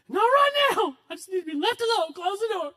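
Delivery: sulky daughter. Retold or imitated. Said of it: "I was like".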